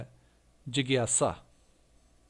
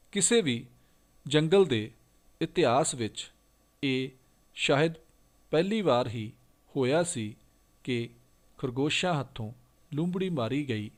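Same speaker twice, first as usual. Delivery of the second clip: louder, emphatic classroom reading; loud reading aloud; 6 dB louder than usual. Acted. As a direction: read it out boldly for the pupils. Reads the Punjabi passage aloud. (ਉੱਚੀ ਆਵਾਜ਼ ਵਿੱਚ ਜਮਾਤ ਲਈ ਪੜ੍ਹਨਾ)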